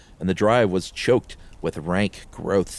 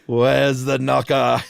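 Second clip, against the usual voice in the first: slow, put-on imitation of partner's Meagle voice; imitating Meagle; 4 semitones higher, 4 dB louder, slower than usual. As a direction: mimic the line you loved, slow and grand. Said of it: "that's my new ringtone for you".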